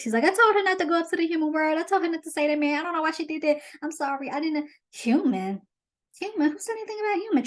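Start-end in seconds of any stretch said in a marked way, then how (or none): 0.2-4.7 high, rapid-fire panicked confession; breaking under pressure. Retold or imitated. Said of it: "And Sebastian breaks". 4.9-7.4 high-pitched mock outrage; hammed-up incredulous anger without shouting; mock mad. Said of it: "Triton gets all mad".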